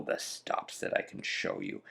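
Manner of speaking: hushed aside, almost voiceless; whisper. Real, not acted. Player whispering his plan to the viewers. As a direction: drop to a secret whisper.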